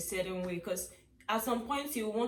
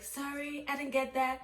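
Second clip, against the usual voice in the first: polite confusion; 4 semitones higher than usual. Retold or imitated. Imitating someone who didn't get "thirty-three", politely confused. Mimics confused listener's reply.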